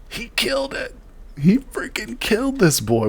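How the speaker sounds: high, breathless thrilled squeal; amazed excitement. Real, not acted. Player discovering the kill, amazed high squeal. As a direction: squeak it out in disbelief.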